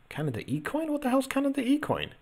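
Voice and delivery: high-pitched incredulous question; bemused, squeaked-up disbelief; baffled. Real, not acted. Baffled by an unknown coin name.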